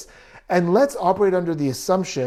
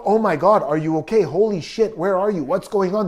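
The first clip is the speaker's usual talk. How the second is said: expected panic; 3 semitones higher than usual. Pretend. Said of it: "There's no panic".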